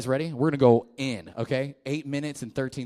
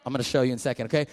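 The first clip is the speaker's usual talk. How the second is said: ordinary talk, nothing marked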